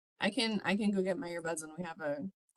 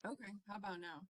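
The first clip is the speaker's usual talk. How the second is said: far from mic; 8 dB quieter than usual. Real, not acted. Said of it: "Just sounds like you're far away".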